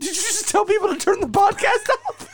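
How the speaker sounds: very high, squealing through laughter; laughing disbelief. Real, not acted. Co-host challenging him over turning listeners away, laughing in disbelief. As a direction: crack up while asking, voice shooting way up.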